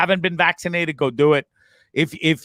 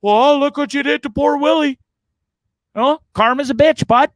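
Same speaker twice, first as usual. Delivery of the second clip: higher mock scolding; mock scolding dog; 9 semitones higher, 4 dB louder than usual. Teasing his old dog about Willie, raised mock scold. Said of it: "I look at him and I was like".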